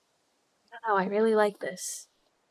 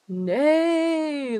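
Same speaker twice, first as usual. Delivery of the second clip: a silly whinny of "Nay", pitched up; horse neigh; 6 semitones higher, 4 dB louder than usual. Pretend. Punning on the horse name with a high whinny.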